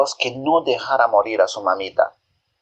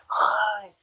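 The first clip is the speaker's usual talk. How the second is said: soft pained ay; 9 semitones higher, 7 dB quieter than usual. A narrator's reading. Mother cries in pain.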